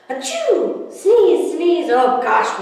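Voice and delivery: big acted sneeze, then sneeze sneeze said loud. Man allergic to kittens sneezes loudly.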